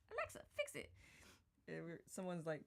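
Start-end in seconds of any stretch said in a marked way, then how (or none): 0.1-0.9 much higher, drawled "Alexa, fix it"; imitating Kim's Southern accent. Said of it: "Y'all have to hear Kim say Alexa".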